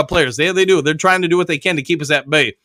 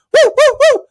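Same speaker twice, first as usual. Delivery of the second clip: high, loud triple woo; woo woo cheer; 5 dB louder than usual. Real, not acted. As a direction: hoot it out for the morning crowd. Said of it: "[name] likes to woo".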